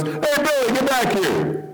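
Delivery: a mother calling her runaway son, pitched high; mom calling out. Retold or imitated. Mom in the grocery store calling her wandering boy, lightly voiced. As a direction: call him back down the aisle. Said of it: "the mom goes".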